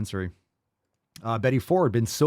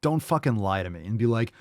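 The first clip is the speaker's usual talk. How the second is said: ordinary talk, nothing marked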